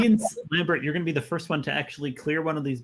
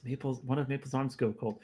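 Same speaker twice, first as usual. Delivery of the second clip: ordinary talk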